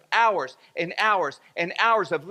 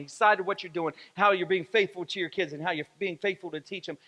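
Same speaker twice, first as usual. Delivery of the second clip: normal talking voice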